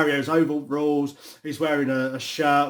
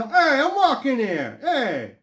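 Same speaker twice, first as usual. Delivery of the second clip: loud, high movie-style Brooklyn holler; Brooklyn accent; 12 semitones higher, 5 dB louder, slower than usual. Shown as a demonstration. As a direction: shout in a hammed-up Brooklyn accent. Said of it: "the typical Brooklyn accent is like".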